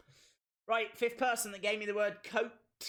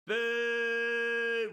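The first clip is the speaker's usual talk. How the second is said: long drawn-out boo, higher; 4 semitones higher, 4 dB louder than usual. Acted. Streamer booing the arriving wrestler, mock crowd boo.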